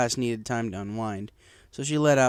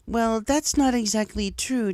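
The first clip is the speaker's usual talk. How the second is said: slow, careful teacher voice, higher; hesitant teacher; 11 semitones higher, slower than usual. Acted. Teacher tries to correct the bully, slow and careful. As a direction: try gently to correct the pupils. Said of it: "Cheerilee tried to say".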